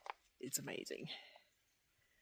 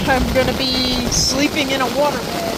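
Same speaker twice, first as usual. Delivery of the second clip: raised voice over the storm; in a thunderstorm; 5 semitones higher, 19 dB louder than usual. Real, not acted. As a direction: talk loud over pounding hail. Storm hammering the tent.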